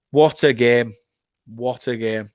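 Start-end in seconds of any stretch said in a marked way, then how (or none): 1.5-2.3 softer repeat, trailing off to himself; quiet echo. Real, not acted. Fan musing to himself after the win, soft. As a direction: murmur it again, savouring it.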